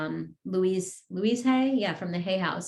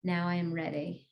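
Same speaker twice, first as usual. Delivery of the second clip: guided meditation. Acted. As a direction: lead the group gently, word by word. Affirmations continue.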